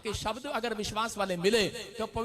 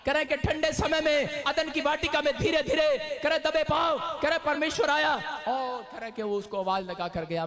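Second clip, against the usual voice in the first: high, loud, ringing preacher voice; preaching at full force; 6 semitones higher, 6 dB louder than usual. Acted. Preacher rousing the church, full-force shouting. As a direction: drive it home to the congregation.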